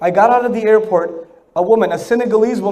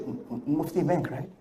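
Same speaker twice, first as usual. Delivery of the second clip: low, soft stammering fan; hesitant brother; 6 semitones lower, 15 dB quieter than usual. Retold or imitated. Fan in Doha stammering his guess, low and soft. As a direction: stammer the name low and shy. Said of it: "Brother comes up to me".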